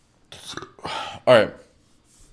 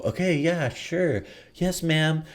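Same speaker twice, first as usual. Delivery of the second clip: innocent angel; 6 semitones higher, 5 dB quieter, slower than usual. Pretend. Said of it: "I play the whole innocent angel".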